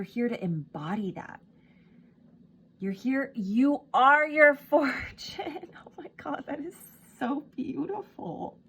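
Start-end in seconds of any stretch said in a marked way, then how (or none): 2.8-5.7 much higher and louder, laughing through it; overflowing joy, laugh breaking in on fortune; emotional burst. Real, not acted. Reader moved by her own reading, laughing emotional burst. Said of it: "I'm, like, so emotional". 5.9-8.6 high and breathless awe; awestruck, caught up in the moment; overwhelmed gasp. Real, not acted. Reader overwhelmed by the card's message, high awe. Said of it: "I'm, like, so emotional".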